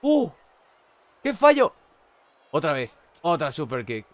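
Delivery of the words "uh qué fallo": loud, high cry at a miss; excited shout. Real, not acted. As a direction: yell out at the blunder. Reacción a un fallo. (grito de sorpresa por el fallo)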